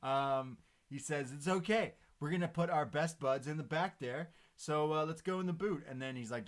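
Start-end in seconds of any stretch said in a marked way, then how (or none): 1.3-5.8 high, loud imitated enthusiasm; upbeat reassurance. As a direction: play the wrestler loud and eager. Acting Riddle's upbeat reply.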